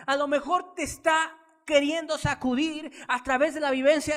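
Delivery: impassioned sermon delivery; fiery preaching. Acted. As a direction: preach it to the congregation with full force. Preaches at full force.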